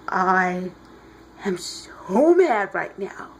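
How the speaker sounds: mock seething anger. Clark rises furious from molten metal.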